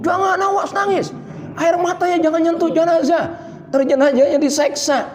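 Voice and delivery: high mock-fretful scolding; fussy villagers. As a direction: mimic the fussing neighbours, shrill and high. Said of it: "Banyak banget di masyarakat yang ribut". (meniru warga yang ribut, suara tinggi)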